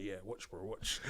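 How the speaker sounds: soft menacing watch; hushed threat. Retold or imitated. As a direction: murmur the threat so the judge can't hear. Whispered threat in courtroom.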